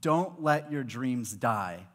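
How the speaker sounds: usual speaking voice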